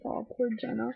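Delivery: a viewer pitying a heartbroken aunt, soft and tender; sympathetic sigh. Retold or imitated. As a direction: coo it like you want to hug her. Feeling bad for Jenna.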